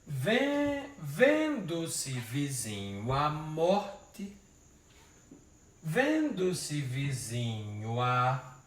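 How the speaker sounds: each syllable drawn out slowly; slow scansion. Shown as a demonstration. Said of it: "vamos ajeitar isso". (escandindo devagar, sílaba por sílaba)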